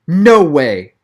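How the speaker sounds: loud, stunned no way; blurting disbelief; shocked disbelief. A narrator's reading. Donovan shocked by reveal.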